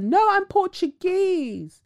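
high, slow, drawn-out insistence; prissy refusal to be called African; mock insistent denier. Mocking a woman who denies her Angolan roots.

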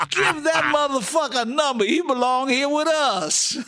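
high, loud laughing yell. Retold or imitated. Inmate yells at the warden, high, loud, laughing. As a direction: holler it across the room. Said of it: "one guy yelled".

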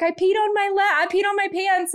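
put-on a little girl confessing an accident; Esme. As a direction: mum doing her daughter's high kid voice. Mum voicing her daughter Esme.

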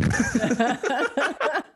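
high, loud burst of laughter; laughing. Real, not acted. Storyteller amused by the doctor's reaction, hearty laugh. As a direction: crack up at your own punchline.

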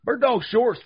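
drawn-out, high, loud announcer call; hyped ad plug. Acted. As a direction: hype the sponsor like a ring announcer. Hyped sponsor plug opening.

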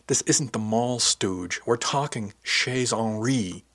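ordinary delivery

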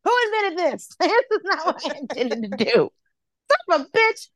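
very high, hammed-up wailing; mock-anguished high protest; dismayed wail. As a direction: wail it like a comic meltdown. Acted dismay at having to write.

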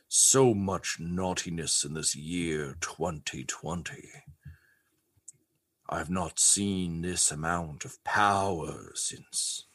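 low, slow, whispery Santa mutter; muttering to himself. Acted. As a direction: brood under the breath, drawing out each phrase. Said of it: "you hear him muttering".

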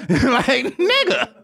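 high-pitched, laughing exclamation; incredulous words through laughter; laughing in disbelief. Real, not acted. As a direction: crack up while calling out a friend. Cracking up at aristocrats joke.

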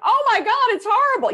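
hammed-up overreaction to a partner's bad day; over-the-top alarm. Shown as a demonstration. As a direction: escalate it, shrieking with dramatized horror. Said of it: "you're not gonna be like".

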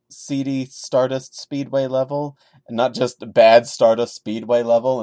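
ordinary delivery

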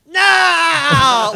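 a high, loud hammed-up cry of No; mock anguished shout. Mocking the actress's anguished No with a high shout. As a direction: shriek it like an overacting nun. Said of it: "she totally did one of these".

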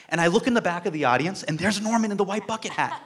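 ordinary talk

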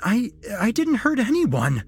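high, stammered whine; whining. A narrator's reading. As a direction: whine it out in loud protest. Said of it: "Jesse whined".

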